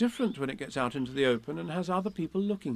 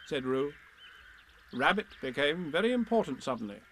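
plain reading voice

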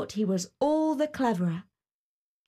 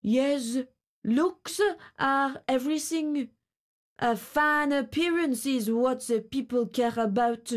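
grasshopper voice; 3 semitones higher than usual. A narrator's reading. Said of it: "and he said too".

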